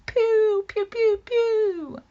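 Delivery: high, loud mock laser-gun noises; playful pretend blaster fire; pew pew sounds. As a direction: fire toy lasers at him. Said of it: "Tell him to take that".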